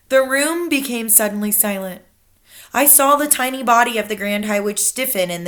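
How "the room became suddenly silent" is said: ordinary delivery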